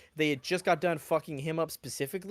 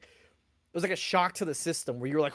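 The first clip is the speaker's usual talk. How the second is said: ordinary talk, nothing marked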